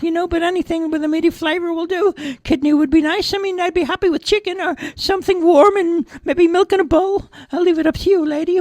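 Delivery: very high cartoon cat voice; talking cat Fluffy. A narrator's reading.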